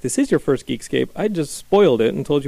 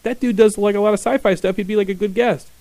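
retold excited remark; 8 semitones higher, 5 dB louder than usual. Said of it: "I was like".

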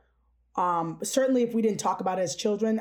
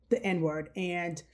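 ordinary talk

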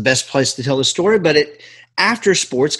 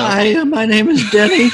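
mock teen voice; 8 semitones higher, 3 dB louder than usual. Mocking Denny introducing himself.

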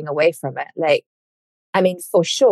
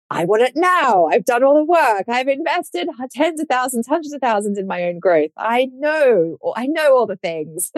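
mock impatient whine; 8 semitones higher than usual. Mocking her impatient past self.

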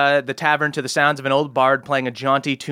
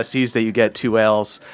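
ordinary talk, nothing marked

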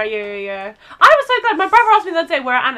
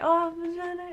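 higher, soft echoed Italian greeting; customers' reply; 4 semitones higher, 9 dB quieter than usual. Retold or imitated. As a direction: imitate diners politely greeting back. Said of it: "And we're all like".

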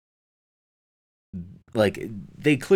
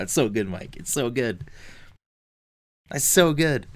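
overcome with emotion; 5 semitones higher than usual. Real, not acted. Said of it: "starting to tear up thinking about it".

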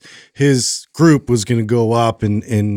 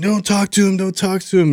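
put-on higher, upset child begging; kid pleading; 8 semitones higher than usual. Son begging dad not to confront officials, pleading. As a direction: play the boy pleading with dad. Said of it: "And he's like".

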